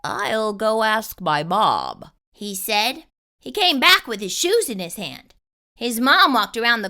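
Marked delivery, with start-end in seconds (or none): 0.0-2.1 put-on lower, slower boy voice; boy character voice. A narrator's reading. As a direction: voice the other boy, slow and low. Jeremy's quote voiced low.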